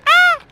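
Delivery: short, very high shriek; sudden loud squeal, followed by a laugh; startled yelp. Real, not acted. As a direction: yelp as if just shot at. Enemy may start shooting back.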